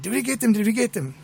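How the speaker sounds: high-pitched, eager kid imitation; excited boy. Retold thrilled boy after pretend shooting.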